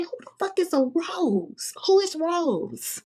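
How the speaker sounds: high, slow, disbelieving question; mock outrage at the cheating boyfriend; high incredulous demand. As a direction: drag it out in outrage. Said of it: "I said".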